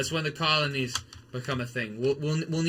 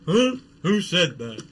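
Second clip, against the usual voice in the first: higher, louder startled who said that; sudden confused alarm; startled huh; 4 semitones higher, 8 dB louder than usual. Pretend. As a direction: jump like someone just called you. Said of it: "It'll be like".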